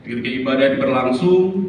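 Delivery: ordinary delivery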